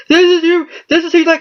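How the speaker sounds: cartoonish high-pitched heel gloat; mock heel taunt. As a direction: shriek it down at the children like a gloating villain. Heel taunting crying kids.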